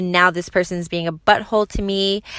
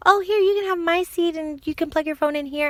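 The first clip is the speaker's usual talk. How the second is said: put-on higher, childlike voice offering help; sweet little kid; 10 semitones higher, 3 dB quieter than usual. Mom imitates the ten-year-old giving up his seat, higher kid voice. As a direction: play the eager little boy.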